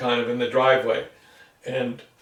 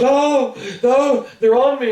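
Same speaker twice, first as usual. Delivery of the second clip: much higher and louder terrified cry; imitated scream; 9 semitones higher, 5 dB louder, slower than usual. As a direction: brother panicking about spiders. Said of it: "he was, like, screaming".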